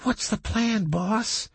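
high, lightly voiced sidekick; character voice. A narrator's reading. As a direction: pitch it up for the sidekick. Character voice, Doc.

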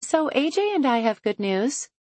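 high, bright and cheerful. A narrator's reading. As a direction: announce it brightly, beaming. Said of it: "Chloe says brightly".

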